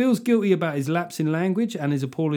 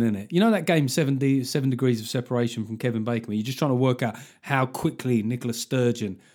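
ordinary talk, nothing marked

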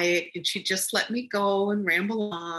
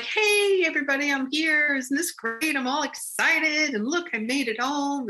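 excited calling out; 8 semitones higher than usual. Retold or imitated. Arriving in heaven, calling out to the shadowy people, excited. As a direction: burst in calling to everyone, thrilled. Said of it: "I was so excited".

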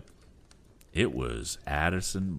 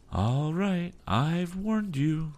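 high, slow final threat; captain's parting warning; 13 semitones higher, much slower than usual. Acted. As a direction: drag out the warning.

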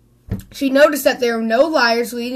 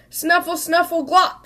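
snuffling mudsucker; 5 semitones higher than usual. A narrator's reading. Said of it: "said the mudsucker".

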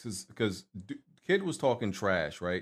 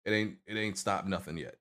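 ordinary delivery

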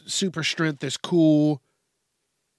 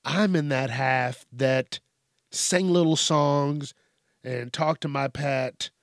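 ordinary talk

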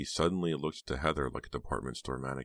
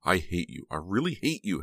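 furious outburst; 3 semitones higher, 3 dB louder than usual. A narrator's reading. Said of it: "Heather screamed".

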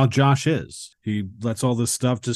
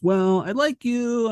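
higher and slow, a hint of a coy woman; lightly voiced Susan; 12 semitones higher than usual. Susan telling Josh she likes him, lightly voiced higher. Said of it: "And Susan goes".

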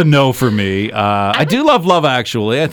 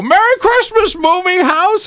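very high joyful mock yell; shouting the tweet aloud in the hero's voice; accented shout; slower than usual. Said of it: "you did it with that accent".

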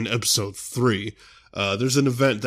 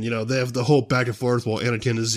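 usual speaking voice

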